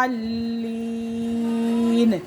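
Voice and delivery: الضالین with the long madd held slowly and drawn out. Shown as a demonstration. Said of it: "بڑا مد ہے اور اس کو ہم لونگ کریں گے". (الضالین میں بڑا مد لمبا کھینچ کر)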